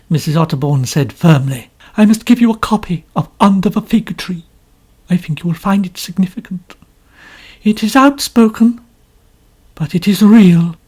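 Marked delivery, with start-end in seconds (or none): 2.0-10.7 much higher, louder complaining lady; complaining novelist. A narrator's reading. Mrs Otterbourne boasting to Poirot, high and complaining. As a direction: preen and grumble at once.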